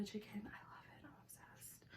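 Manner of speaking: soft whispered food chat; whispering. Real, not acted. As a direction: whisper it close to the mic. Whispering over food in mukbang.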